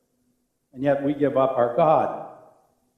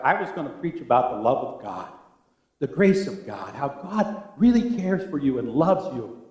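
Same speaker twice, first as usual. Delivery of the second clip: usual speaking voice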